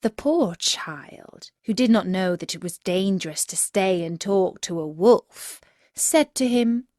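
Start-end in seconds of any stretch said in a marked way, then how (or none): none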